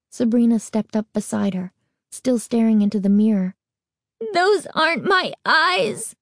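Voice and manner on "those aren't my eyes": high slow wail, "Those" rising. A narrator's reading. Girl at the mirror wailing, high and slow. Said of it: "Carly Beth wailed".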